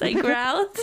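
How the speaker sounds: ordinary delivery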